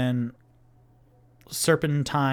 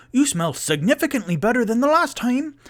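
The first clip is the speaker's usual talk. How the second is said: high, louder cheery halfling; halfling host; 10 semitones higher, 5 dB louder, faster than usual. Acted. Mister Peabody teasing the visitors about their smell, high and cheery. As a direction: tease them in a high bright voice. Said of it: "Mister Peabody goes".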